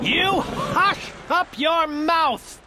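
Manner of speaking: slow, high-pitched kingly howl; howled order. A narrator's reading. Turtle king silencing the starving turtle, outraged howl. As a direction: draw out the furious command. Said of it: "howled the mighty King Yertle".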